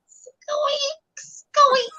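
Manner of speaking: squeaky hook noise, high and thin; high squeaks. Retold or imitated. Teacher imitating a squeaking hook in class, high mock squeaks. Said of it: "I would hear the squeaking".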